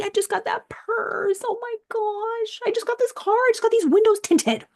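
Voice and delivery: high, crying mock lament over the car; mock wailing dismay. Retelling her car break-in, a high mock wail. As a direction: wail it like a half-real sob.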